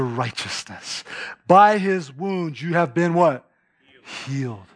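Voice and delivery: low, hushed, slow verse. Acted. Preacher reading First Peter to the congregation, hushed and slow. Scripture read softly.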